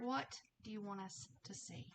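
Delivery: usual speaking voice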